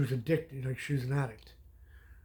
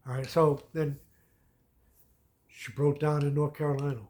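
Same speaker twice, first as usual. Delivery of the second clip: ordinary delivery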